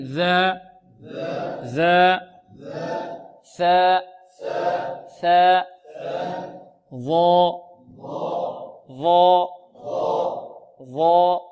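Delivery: "ذا، ثاء، ظا" held long, tongue at upper teeth; tongue-tip articulation. Shown as a demonstration. Teacher leads students in repetition, interdental letters drawn out. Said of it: "انطقوا معي".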